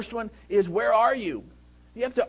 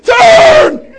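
one sudden high, loud yelled command; mock panicked shout; 24 dB louder than usual. Son imitating dad yelling at him to turn, sudden shout. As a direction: yell the command in alarm. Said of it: "impersonation of me".

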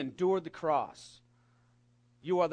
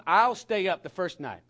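normal talking voice

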